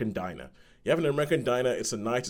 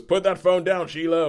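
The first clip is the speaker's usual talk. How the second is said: raised, louder voice, imitating a command; acted sharp order; 7 semitones higher, 6 dB louder than usual. Man ordering a diner customer off her phone, acted sharp order. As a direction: call it out higher and louder, in imitation. Acting out the film hero's order.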